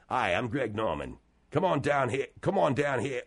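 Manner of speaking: low-pitched, attempted Australian accent; Greg Norman. Shown as a demonstration. Host trying an Australian accent for callers, low attempted Australian. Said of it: "I'll try an Australian accent".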